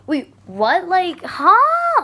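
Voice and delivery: baffled, rising to a high, loud "huh"; incredulous and puzzled, building up; retold confusion. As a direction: act out being totally thrown. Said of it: "I was so confused".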